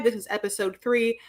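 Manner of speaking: usual speaking voice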